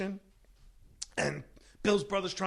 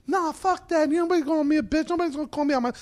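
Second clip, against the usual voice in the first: much higher, louder indignant retort; offended outburst; 12 semitones higher, 5 dB louder, faster than usual. Retold or imitated. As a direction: bristle at being called out. Promoter offended, high.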